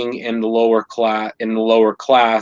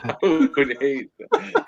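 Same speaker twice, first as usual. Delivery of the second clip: laughing; 4 semitones higher, slower than usual. Real, not acted. Laughing at the thought of old age.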